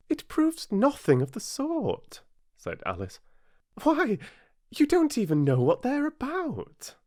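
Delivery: put-on high, loud indignant girl. A narrator's reading. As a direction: protest hotly. Alice protests.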